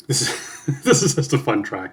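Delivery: real laugh breaking through the words. Real, not acted. Reacting to a fun rap track, laughing as he talks. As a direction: chuckle your way into the line.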